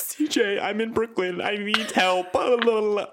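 high, loud pleading; drunk panicked friend. Retold or imitated. Drunk friend begging CJ for help, high and loud. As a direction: wail for help like a lost drunk.

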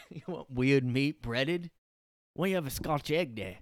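hammed-up British pub accent. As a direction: push snacks in a mock British voice. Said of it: "I can't do the accent".

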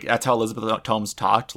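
normal talking voice

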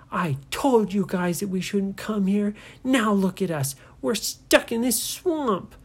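angry sister blaming her brothers; mad told-you-so. Acted. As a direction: snap at them, fuming. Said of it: "she was getting so mad".

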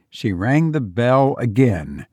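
plain reading voice

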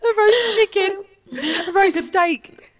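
laughing. Real, not acted. Laughing while listing smells.

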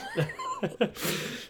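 squealing laugh, high up; high burst of laughter. Real, not acted. Laughing at own story.

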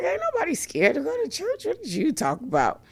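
high, fast, sassy put-on voice; sassy comeback. Brushing off her mother's taunt, a high, fast, sassy retort. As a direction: toss it back with attitude. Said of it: "I was like".

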